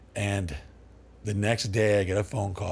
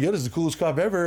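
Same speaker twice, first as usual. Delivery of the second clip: delighted; 7 semitones higher, much faster than usual. Retold or imitated. Delighted by the cop.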